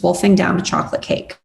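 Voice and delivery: usual speaking voice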